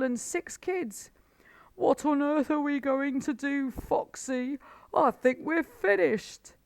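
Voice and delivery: high, loud, frantic old badger; a worn-out badger begging for help; desperate Badger. A narrator's reading.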